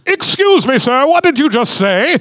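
high-pitched hammed-up affront; mock-scandalized, pitch leaping up; religious indignation. Religious spirit challenging the preacher, high mock indignation. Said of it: "the religious spirit just being like".

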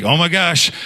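high, mildly panicked imitation; mock flustered exclamation; freaked out. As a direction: act out a flustered friend. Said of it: "that freaked him out even more".

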